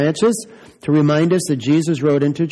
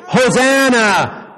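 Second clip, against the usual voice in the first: higher, louder, slow drawn-out Hosanna!; loud group shout; 9 semitones higher, 4 dB louder, much slower than usual. Shown as a demonstration. Leading the children, shouting it loud together. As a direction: stretch Hosanna! out and belt it. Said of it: "Let's all say it loud".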